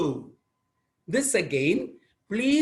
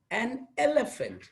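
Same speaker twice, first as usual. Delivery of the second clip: ordinary talk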